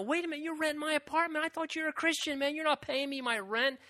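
high, fast incredulous protest; indignant landlord. Retold or imitated. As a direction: rattle off the outrage at the unpaid rent. Acted landlord complaint.

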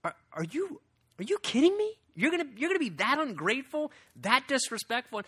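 high, incredulous, fed up; a dad who can't believe his kids' ingratitude; father to ungrateful kids, high and incredulous; exasperated father. Retold or imitated. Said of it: "it's kinda like".

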